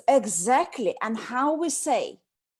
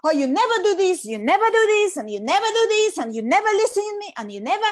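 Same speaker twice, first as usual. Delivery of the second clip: much higher and louder, fast accusing rant; shrill nagging; 7 semitones higher, 6 dB louder, faster than usual. Retold or imitated. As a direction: hammer him with every complaint. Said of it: "she's like".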